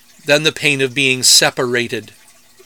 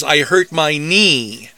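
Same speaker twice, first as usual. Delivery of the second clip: "I hurt my knee" slow, landing hard on "knee"; a slow stress demo ending on "knee"; slower than usual. Shown as a demonstration. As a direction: lean into the last word. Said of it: "stronger emphasis should be on the last syllable".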